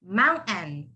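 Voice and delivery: "mountain" as moun, catch, "en"; silent T. Shown as a demonstration. Teacher demonstrates the rule, silent T in mountain. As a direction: swallow the T in "mountain", end on N. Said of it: "the T becomes silent and you would replace it with the N".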